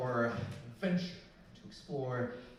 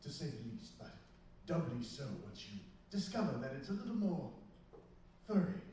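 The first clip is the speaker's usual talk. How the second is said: hushed dramatic trailer voice; documentary narrator; 5 dB quieter than usual. Acted. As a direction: build mystery like a film trailer. Dramatic trailer-style intro.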